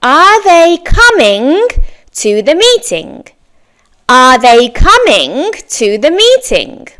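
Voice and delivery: slow model question. Shown as a demonstration. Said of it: "and then my verb plus ing".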